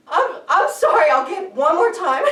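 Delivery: high, loud, laughing sorry; apologising to the cat after dropping the mouse; frantic high apology. Retold or imitated. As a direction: plead with the cat through a laugh. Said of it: "And I said".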